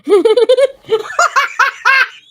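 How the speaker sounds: loud, high-pitched cackling laugh; big shrieking belly laugh; uproarious laughter. Real, not acted. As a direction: burst out in real, helpless laughter. Cracks up at what she sees.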